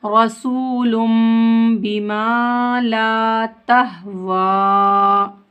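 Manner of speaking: रसूलुम बिमा ला तहवा chanted slowly, humming the m; ghunna recitation. Shown as a demonstration. Teacher modelling the verse, held nasal m in रसूलुम बिमा. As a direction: stretch long vowels in रसूलुम बिमा ला तहवा. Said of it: "गुन्ना इख्फा के साथ पढ़ेंगे".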